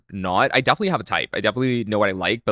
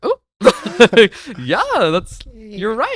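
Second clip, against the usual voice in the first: high, slow, laughing mock agreement; laughing sarcastic agreement; 12 semitones higher, 8 dB louder, much slower than usual. Retelling a date's rant to the host, sarcastic high agreement. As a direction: humour him with a high, drawn-out giggle.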